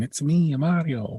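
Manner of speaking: put-on high, hammy Italian accent as Mario; bad Italian accent. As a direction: ham up a cartoon Italian accent. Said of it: "with a really bad, horribly racist Italian accent".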